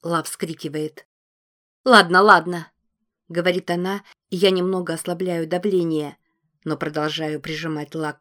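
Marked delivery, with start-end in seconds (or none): 1.8-2.7 much louder, desperate give-in; panicked surrender. A narrator's reading. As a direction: blurt it out in panic. Choked girl gives in.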